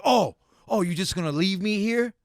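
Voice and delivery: loud, high mock indignation; overly emotional friend. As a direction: imitate a friend protesting being abandoned. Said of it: "overly emotional about everything".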